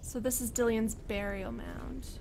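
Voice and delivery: ordinary delivery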